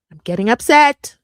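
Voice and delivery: loud, rising exasperated outburst; getting upset. Real, not acted. Vlogger admitting anger to viewers, loud exasperated burst.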